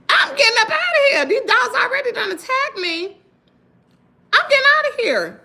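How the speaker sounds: high, frantic, half-laughing; frantic retelling. Retold or imitated. Fleeing attacking dogs, high and frantic. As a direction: relive the panic of fleeing the dogs.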